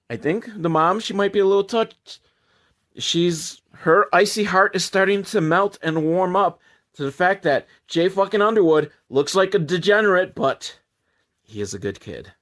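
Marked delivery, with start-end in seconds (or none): none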